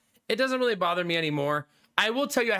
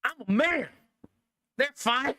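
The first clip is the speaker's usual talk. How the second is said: mock macho dismissal; 5 semitones higher than usual. Streamer retelling telling wife he won't see dermatologist, mock macho. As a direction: scoff it off like a proud man. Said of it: "And I was like".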